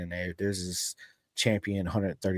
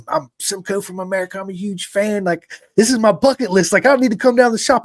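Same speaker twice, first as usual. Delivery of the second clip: hyped fan calling a shop, pitched much higher and louder; intense excited fan; 10 semitones higher, 8 dB louder than usual. Retold or imitated. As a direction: gush like a superfan at his bucket-list moment. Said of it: "your energy through the phone is just intense".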